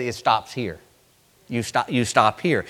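low soft steady voice; calm reassurance. Acted. Jesus to sinking Peter, low calm reassurance. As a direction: settle him gently.

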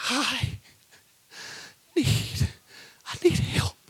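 whispered, halting plea; hushed plea. Retold or imitated. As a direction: barely voice the words.

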